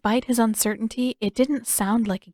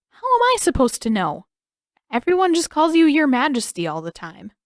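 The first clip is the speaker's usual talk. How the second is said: higher, louder exasperation; exasperated Aang; 6 semitones higher, 6 dB louder than usual. A narrator's reading. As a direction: throw up your hands at the riddle.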